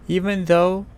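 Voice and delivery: usual speaking voice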